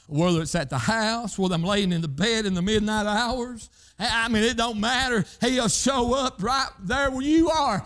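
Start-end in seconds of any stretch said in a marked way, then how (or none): 4.0-7.8 preaching at full force. Acted. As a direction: land the promise with a shout. Closing shout.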